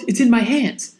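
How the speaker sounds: plain reading voice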